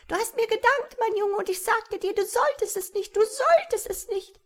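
very high, taunting imp voice; spiteful high-pitched goblin; squawky malicious creature. A narrator's reading. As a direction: taunt in a shrill little squawk. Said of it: "das Quäken der boshaften Stimme".

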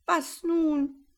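high, loud panicky cry; panicked cry. A narrator's reading. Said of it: "in heller Aufregung".

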